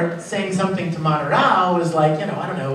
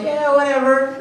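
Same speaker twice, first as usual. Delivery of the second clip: careless, offhand brush-off; bored dismissive; 7 semitones higher than usual. Retold or imitated. Ed shrugging off Al's cheer, offhand. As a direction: toss it off like a bored big brother. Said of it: "And I'm like".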